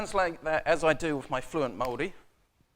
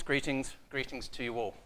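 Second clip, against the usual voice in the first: normal talking voice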